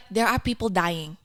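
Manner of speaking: put-on light Filipino accent, matter-of-fact; a hint of a Filipino mum brushing it off. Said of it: "why did that accent so well?".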